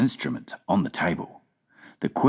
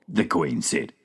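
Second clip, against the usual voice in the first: ordinary delivery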